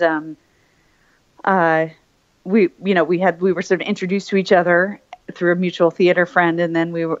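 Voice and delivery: ordinary talk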